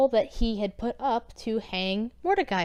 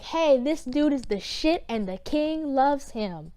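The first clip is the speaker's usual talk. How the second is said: drawn-out, higher town-crier announcement; slow, raised-pitch parade announcer; mock herald proclamation; 5 semitones higher, slower than usual. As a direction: proclaim it slowly to a public square. Prince proclaiming in the square.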